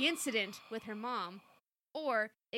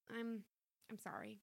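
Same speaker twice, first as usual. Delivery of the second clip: quiet apology; 9 dB quieter than usual. A narrator's reading.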